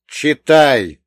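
brusque, forceful imperative; sharp order. A narrator's reading. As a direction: order them loudly and abruptly. Brusque order, thrusting the document.

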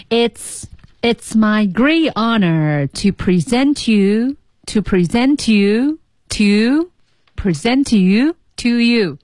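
slow "it's my great honor to present you", "to" squeezed small; teacher rhythm practice; rhythm drill. Shown as a demonstration. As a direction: practice the linking slowly. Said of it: "不重要的介词会被吞掉".

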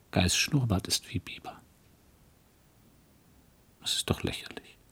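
a hushed inner whisper to herself; whispered thoughts. Acted. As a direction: breathe it like a guilty secret. Private musing on Guy's moustache.

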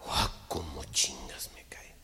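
whisper. Acted. Aparte susurrado del hombre.

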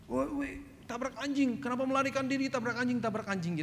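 high-pitched accusing calls from a gang; imitated mob heckling. Mob accosts him from behind. (meniru gerombolan menuduh, bernada tinggi)

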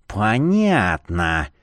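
slow, low, angry hissed word. Acted. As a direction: hiss it out angrily. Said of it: "сердито прошипела Люсенька".

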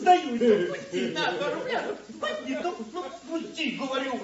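breathless laughing surrender; high giggling, begging to stop; being tickled. Acted.

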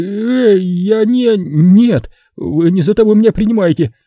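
high, frantic begging pimp voice; a terrified bar owner offering all his money; panicked pimp pleading. Acted. Terrified Simon begging the killer to take the money, high and frantic.

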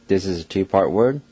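ordinary talk

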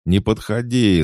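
high, loud desperate cry; sobbing. A narrator's reading. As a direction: warn it off, sobbing with terror. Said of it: "зарыдал бандит".

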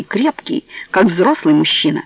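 ordinary delivery, nothing marked

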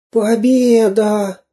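high, slow, weakened voice; faint victory cry. A narrator's reading. Exhausted Самоделкин barely manages his victory cry, faintly. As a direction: strain out a failing cry of triumph. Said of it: "сказал Самоделкин еле слышно".

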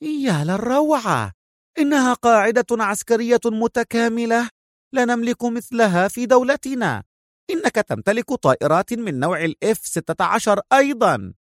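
high-pitched awestruck woman; dazzled exclamation. A narrator's reading. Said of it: "وصاحت سونيا في انبهار".

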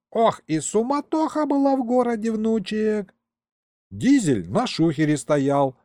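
put-on lively old man, often high-pitched; storytelling grandpa. Grandpa telling his grandson of the caper, animated and proud.

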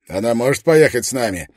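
loud. A narrator's reading. Soldier asking loudly.

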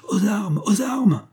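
high-pitched rallying cry, held in narration; cri d'alarme. A narrator's reading. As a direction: cry out a high call to arms. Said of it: "cria-t-il aux voyageurs". (cri d'alarme aigu)

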